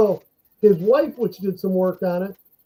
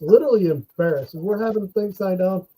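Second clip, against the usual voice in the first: ordinary talk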